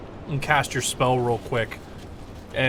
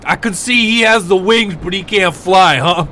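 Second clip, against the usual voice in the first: griffin voice; 6 semitones higher, 9 dB louder than usual. Acted. Griffin answers about flying.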